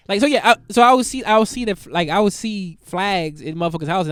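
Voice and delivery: ordinary talk, nothing marked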